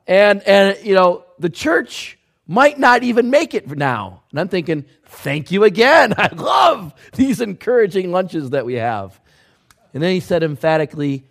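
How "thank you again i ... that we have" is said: loud, high sarcastic thank-you with a laugh; hammed-up mock gratitude, laughing. Sarcastic thought during lunch.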